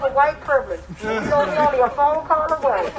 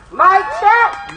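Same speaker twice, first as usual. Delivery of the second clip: higher, louder call to the crowd; mic check call; 7 semitones higher, 4 dB louder than usual. Real, not acted. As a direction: ring it out for a call-and-response. Calling the crowd to attention.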